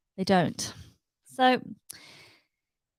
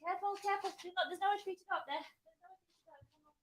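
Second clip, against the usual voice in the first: calling off mic; 7 semitones higher, 19 dB quieter, faster than usual. Real, not acted.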